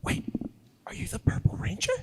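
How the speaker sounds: high, soft whispered question; whispered surprise. Retold or imitated. [name] imitating Claire's discovery, high whisper. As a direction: whisper it in a sudden realisation. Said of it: "she goes".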